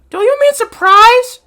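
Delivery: put-on very high, loud girlish question; mock girl voice. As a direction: squeal the question as an exaggerated girl. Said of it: "Girl one".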